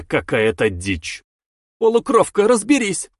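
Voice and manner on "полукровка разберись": high, loud commanding yell; shouting. Acted. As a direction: bark the order across the room. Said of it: "крикнула девушка".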